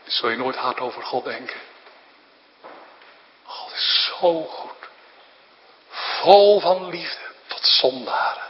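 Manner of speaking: normal talking voice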